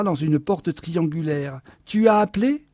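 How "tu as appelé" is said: louder, higher questioning snap; irritated husband's question. A narrator's reading. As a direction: ask it with irritation, pitch rising. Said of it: "demanda-t-il avec irritation".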